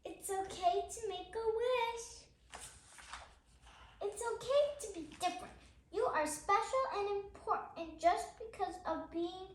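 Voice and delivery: steady, gentle picture-book reading; reading to children. Acted. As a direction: read each page out to little listeners. Reads picture book aloud.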